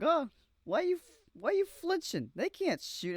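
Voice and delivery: very high, loud squeaky mock officer; shrill cartoon scolding of flinching men; mocking general. Mocking the general scolding his flinching men, high squeak. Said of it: "as he was getting on them".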